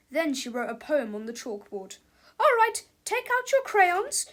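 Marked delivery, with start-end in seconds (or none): 2.4-4.3 loud, high, harsh teacher. A narrator's reading. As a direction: order the class about. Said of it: "said Miss Ribble harshly".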